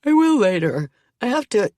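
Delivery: high, loud yawn through the words; sleepy child putting it off mid-yawn. A narrator's reading. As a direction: talk through a big yawn. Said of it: "He yawns deeply".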